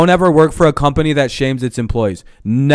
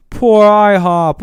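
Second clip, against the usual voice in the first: mock pity; 7 semitones higher, 4 dB louder, much slower than usual. Mocking ihop's complaint.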